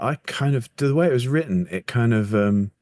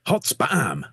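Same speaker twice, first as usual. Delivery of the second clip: higher-pitched mock battle exclamation; punchy quoted cry; 6 semitones higher than usual. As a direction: blurt it out like a comic minion's catchphrase. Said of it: "responds with the exclamation".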